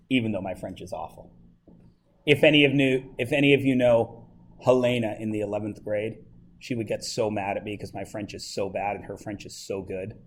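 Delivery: normal talking voice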